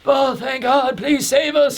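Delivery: high, pleading cry for rescue; desperate relief. Retold or imitated. Starving agents begging Shaka to save them, high pleading relief. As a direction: beg like starving prisoners finally seeing help. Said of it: "The Cypher Pole agents are like".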